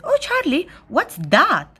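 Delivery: high little-sister exclamation; feigned surprise. A narrator's reading. Little sister distracts brother from the game, feigning surprise. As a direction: distract him with sudden wonder.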